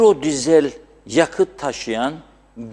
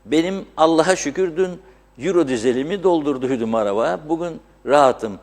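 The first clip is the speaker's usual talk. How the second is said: ordinary delivery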